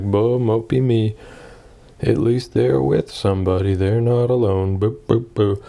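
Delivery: slow, mopey mock whine; mock self-pity. Mocking his own moping over the girl, slow mock whine. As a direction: sulk at yourself, half joking.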